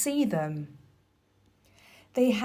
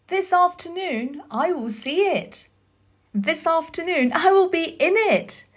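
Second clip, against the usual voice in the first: eager; 8 semitones higher, 4 dB louder than usual. Acted. Colin eager to see garden.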